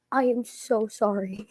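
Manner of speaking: small, soft, slow retold apology; slow, contrite mock-meek delivery; sheepish apology. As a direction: act out being mortified, voice dropped to a hush. Retold sheepish apology to park employee.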